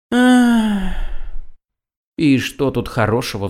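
high sighing "Ах", then a weary question. Acted. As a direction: sigh it out like a tired cat. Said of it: "вздыхает кот".

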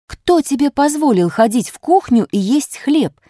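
furious mother yelling; angry shout. A narrator's reading. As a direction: scold the daughter at full voice. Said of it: "сердито закричала мать".

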